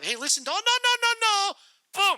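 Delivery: hammed-up alarm; panicked yell. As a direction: cry out as she goes down. Lady falling over.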